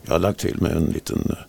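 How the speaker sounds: low, soft, veiled voice; real whisky-cracked huskiness. Real, not acted. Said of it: "beslöjad röst sådär".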